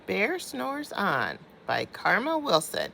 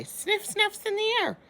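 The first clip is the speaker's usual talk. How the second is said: higher, playful sniffing line; sniffing badger; 14 semitones higher than usual. Narrator mimics badger sniffing.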